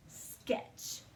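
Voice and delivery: "Sketch" whispered, each sound crisp; whispered model word. Shown as a demonstration. Teacher whispering the spelling word for pupils to listen. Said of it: "S-K-E-T-C-H".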